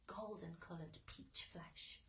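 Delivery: plain reading voice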